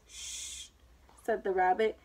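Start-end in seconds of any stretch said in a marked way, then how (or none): 0.1-0.7 soft, drawn hushing sound; whispered shush. A narrator's reading. Rabbit silencing Alice about the Duchess, whispered shush. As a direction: hush them under the breath.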